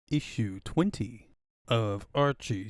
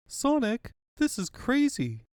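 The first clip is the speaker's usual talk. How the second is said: much higher, worried whisper-urgent; high anxious princess voice; 11 semitones higher, 4 dB louder than usual. Acted. Princess warning Sonic of swat bots, anxious. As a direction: warn Sonic nervously.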